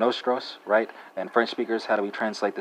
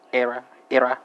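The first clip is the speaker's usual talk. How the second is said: "era" with the opening E stressed and higher; emphasis on the E; 4 semitones higher, 5 dB louder than usual. Shown as a demonstration. Teacher giving Swedish learners their pronoun, "era" with stressed E. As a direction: lean on the first vowel of "era". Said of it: "putting emphasis on the E".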